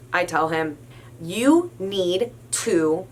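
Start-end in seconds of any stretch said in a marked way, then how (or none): 1.2-3.1 slow, firm, every word spaced; stern. Retold or imitated. Clerk ordering the drugged man to leave, slow and stern. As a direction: lay down the law slowly. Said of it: "I'm basically getting so stern".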